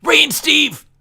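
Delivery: high, loud, over-the-top shout of "Ray and Steve!"; yelled out. Retold or imitated. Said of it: "Bradley yells out".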